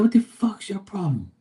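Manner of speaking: high, sharp retold challenge; mock angry yell. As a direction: snap it back at the rude old guy. Confronting the impatient driver.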